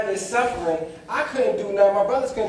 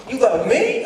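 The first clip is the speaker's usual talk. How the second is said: raised pitch, disbelieving question; incredulous; 5 semitones higher, 4 dB louder than usual. Retold or imitated. Son hearing father say he loves him, incredulous. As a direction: question it in disbelief, rising at the end.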